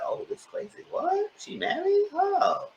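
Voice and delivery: squeaky stunned gasps of surprise; a breathless high take of shocked news. Retold or imitated. Brian reacts to the news.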